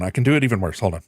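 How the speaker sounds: normal talking voice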